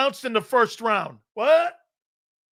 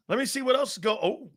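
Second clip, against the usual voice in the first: usual speaking voice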